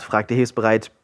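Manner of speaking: plain reading voice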